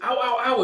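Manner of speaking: high, loud ow ow ow; mock yelps of pain. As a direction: yelp like a knife just hit you. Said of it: "she's like".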